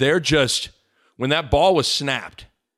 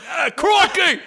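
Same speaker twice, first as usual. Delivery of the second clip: shouted, very high hammed-up British whine; mock-wail it like a comic spy; Austin Powers impression; 14 semitones higher, 4 dB louder, slower than usual. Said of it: "before the game he's like".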